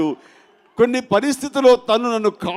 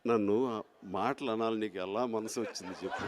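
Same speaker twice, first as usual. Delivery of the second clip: hushed mock hurt; 10 semitones lower, 14 dB quieter than usual.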